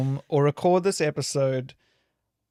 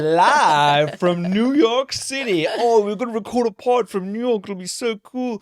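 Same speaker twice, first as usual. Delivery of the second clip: mock hype; 7 semitones higher, 5 dB louder than usual. Host parroting his plan to record in New York, mock hype. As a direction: send up your own big plan, loud at the start. Mocking own earlier podcast plan.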